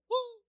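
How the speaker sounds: high-pitched, soft "uh" whoop; small, high demonstration whoop; shout of jubilation. Shown as a demonstration. Preacher showing a cry of joy, high restrained whoop. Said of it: "un grito de júbilo".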